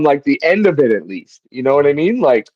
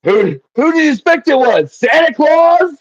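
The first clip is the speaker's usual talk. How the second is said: emphatic yell; 10 semitones higher than usual. Retold or imitated. Wheels yelling at the group in the elevator, mock emphatic yell. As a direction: yell it emphatically like a half-deaf man. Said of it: "he just yells it so emphatically".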